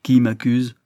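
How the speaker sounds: plain reading voice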